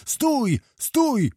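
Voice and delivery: high-pitched call of command. A narrator's reading. Tyzenhaus calls to dark riders to halt, high-pitched. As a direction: call out high, each word falling sharply. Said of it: "począł wołać Tyzenhaus".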